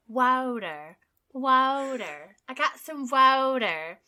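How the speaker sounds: Water as wah-der with a flapped d and r; slow, American water; American accent. Shown as a demonstration. Co-host practising the American Water. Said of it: "That's how you do it".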